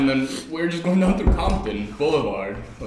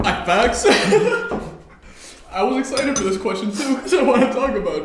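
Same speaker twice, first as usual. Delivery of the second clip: laughing; 11 semitones higher, 6 dB louder than usual. Real, not acted. Laughing at friend's joke.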